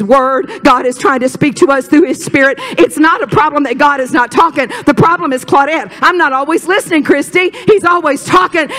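high, urgent preacher's exhortation; fervent preaching. Real, not acted. Preacher urging the congregation to listen to God, fervent preaching.

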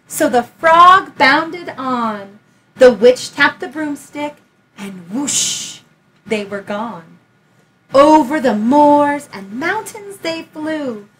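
put-on lively read-aloud for a child; story narration. A narrator's reading. Teacher reading Room on the Broom aloud, lively storytelling. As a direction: tell it like a bedtime picture book. Reads picture book aloud.

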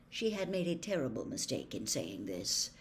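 ordinary delivery, nothing marked

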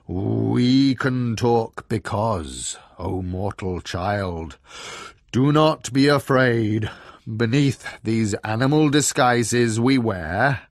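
slow, booming bear declamation; pompous proclamation. A narrator's reading. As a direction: intone it grandly like a god addressing a mortal. Bear makes grand godly proclamation.

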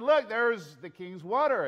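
yelling. Acted. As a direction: call out to make him look. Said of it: "as David is yelling out to Abner".

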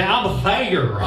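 "I'm there" in a hammed-up southern drawl, "there" stretched to "thay-uh"; a jokey southern twang on "I'm there"; southern accent. Shown as a demonstration. Pastor joking with the congregation in a mock southern accent. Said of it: "Say it with a southern accent".

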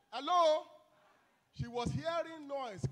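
usual speaking voice

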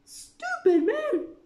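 high, loud, slow scornful yell; furious queen shout. A narrator's reading. Queen scolds the knave, shouting furiously. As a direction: bellow it as the angry Queen. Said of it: "shouted the queen".

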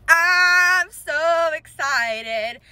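slow, stretched, high giddy wail; drawn-out excitement. Real, not acted. Collector repeats her joy to viewers, drawn-out excitement. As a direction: drag out every word with joy.

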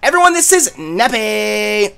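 drawled-out chatty coffee-shop type; Starbucks voice. Acted. Player voices Serena introducing a friend, Starbucks voice. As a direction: stretch the line endings in character. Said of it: "give Serena the Starbucks voice".

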